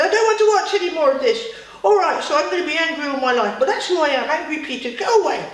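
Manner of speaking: storyteller's loud, upset Peter; upset outburst. A narrator's reading. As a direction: burst out loudly, fed up and upset. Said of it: "a bit sad and a bit upset".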